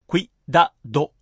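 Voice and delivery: クイダド in three separate beats, kui-da-do. Shown as a demonstration. Teacher counts out the timing, クイダド in three beats. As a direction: keep クイ as one syllable in クイダド. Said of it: "三拍です".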